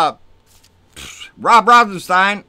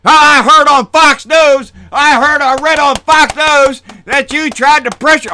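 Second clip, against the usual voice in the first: mocking Jordan; 8 semitones higher, 8 dB louder than usual. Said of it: "Jordan's over there trying to get on".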